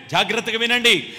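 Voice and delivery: loud, slow, hammering declaration; full-force preaching. Acted. Preacher declaring to the congregation, loud and slow. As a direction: pound each word home.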